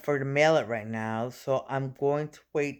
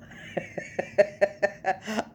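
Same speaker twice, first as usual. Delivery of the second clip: breathy little laugh; 6 semitones higher than usual. Real, not acted. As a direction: let a laugh slip out. Laughs at own resolve.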